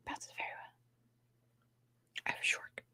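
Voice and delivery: hushed show and tell whisper; soft whispered aside. Real, not acted. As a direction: whisper it like a secret. Showing toys on stream.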